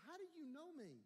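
high-pitched, puzzled challenge; retold bewilderment. Preacher questioning the stranger who knows him, bewildered. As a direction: act out being baffled by a stranger.

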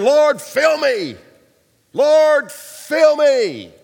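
loud, slow pleading cry to God; loud drawn-out worship cry; fervent cry. Retold or imitated. Man crying out in church.

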